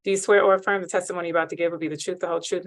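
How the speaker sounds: ordinary delivery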